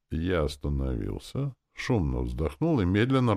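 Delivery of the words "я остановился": usual speaking voice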